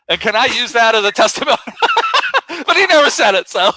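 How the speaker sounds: high, laughing through it. Real, not acted. Mocking his own hoped-for testimony, laughing. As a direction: laugh at the punchline.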